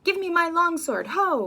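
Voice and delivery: loud, high angry call for his sword; high loud furious demand; hot-tempered demand. Acted. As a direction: roar for the sword. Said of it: "he comes out and he goes".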